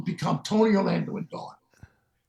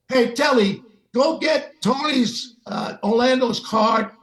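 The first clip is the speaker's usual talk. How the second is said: raised, louder voice giving an order; bossy call; 4 semitones higher, 4 dB louder than usual. Retold or imitated. Sinatra sends Telly for a place card, calling out bossily. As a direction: imitate a boss calling to a helper.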